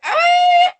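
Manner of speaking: high, loud, amazed DJ cutting the music; surprised DJ. Retold or imitated. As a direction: call it out like a stunned DJ who stopped the track. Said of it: "He's like".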